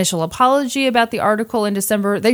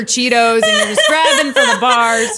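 laughing; 12 semitones higher, 5 dB louder than usual. Real, not acted. Joking about being high.

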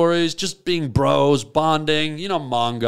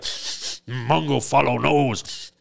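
slow caveman voice, sniffing along; slow dim caveman following his nose; caveman talk; 2 semitones lower, slower than usual. Retold or imitated. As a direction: drag it out like a brute on a scent. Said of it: "he still talks like this".

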